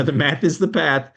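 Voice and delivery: American accent, "path" with the flat a of "math"; American accent, "path" rhymes with "math". Shown as a demonstration. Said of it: "this only works in an American accent".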